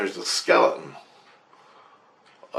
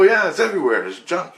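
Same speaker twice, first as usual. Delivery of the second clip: higher-pitched brush-off; dismissive crew; 8 semitones higher, 4 dB louder than usual. Retold or imitated. Crew dismisses the gadget he found, brushing it off. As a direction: wave it away, voice pitched up.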